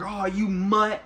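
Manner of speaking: high-pitched, put-on yell at a dog; mock yell. Man walks in yelling at the dog, mock yell. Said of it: "yelling at him".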